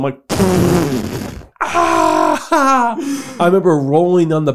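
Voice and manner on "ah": helpless burst of laughter, re-enacted; high cackling outburst, acting out cracking up; losing it laughing. Son re-enacting laughing at his sister's news, losing it. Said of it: "I fucking lost it".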